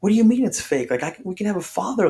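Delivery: retold disbelief, high and insistent; incredulous protest. Acted indignant reply to buddies.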